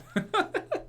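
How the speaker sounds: a short, high, rising giggle; giggling in disbelief. Real, not acted. Vlogger giggling at the dub of Shane and Dale.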